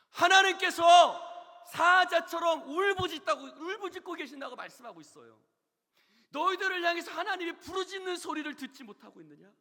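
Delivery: loud, high-pitched pulpit shout; full-force preaching. Real, not acted. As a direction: preach it out like a roar.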